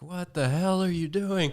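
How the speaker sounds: high-pitched imitation of an alarmed yell; retold yelling. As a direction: imitate someone yelling at a trespasser. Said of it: "yelling at me".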